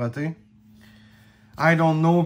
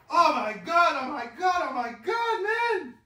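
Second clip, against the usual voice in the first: excited gasping; 12 semitones higher than usual. Real, not acted. Thrilled by card pull.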